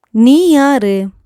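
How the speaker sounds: sharp, loud, high who-are-you; angry. Shown as a demonstration. Teacher demonstrating an angry who-are-you to learners. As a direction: demand it angrily, voice jumping up. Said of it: "இந்த மாதிரி கோவமா சொல்லுவோம்".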